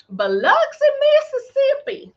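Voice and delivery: a playful Southern Mississippi pronunciation of "Biloxi, Mississippi"; local Mississippi way. Shown as a demonstration. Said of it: "I don't know why I have to say it like that".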